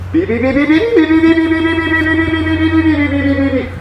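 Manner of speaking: rapid beeps rising, then held very high; sustained high-pitched alarm beeping; frantic variometer beeps. Shown as a demonstration. Imitates variometer in strong lift.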